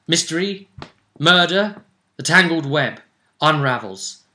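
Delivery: normal talking voice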